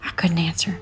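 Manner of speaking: plain reading voice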